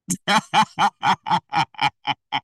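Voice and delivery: long burst of chuckles in a steady string; real laugh. Real, not acted. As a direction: laugh helplessly at your own joke. Laughs at own ageing joke.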